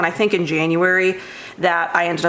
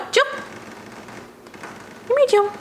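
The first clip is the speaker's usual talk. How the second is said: high sing-song call to a cat; 4 dB quieter, faster than usual. Real, not acted. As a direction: call the cat over in a much higher voice. Calling the cat to come.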